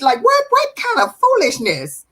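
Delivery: high, scornful "what kind of foolishness"; exasperated. Real, not acted. Host scolding the pretender, high and scornful.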